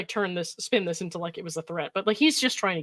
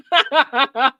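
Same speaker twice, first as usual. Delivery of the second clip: short loud laugh; 6 semitones higher, 9 dB louder than usual. Real, not acted. Laughing at the text.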